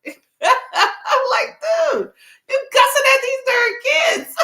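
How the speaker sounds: high squealing laughter through the words; can't stop laughing at him; laughing disbelief. Real, not acted. Laughing at cussing character.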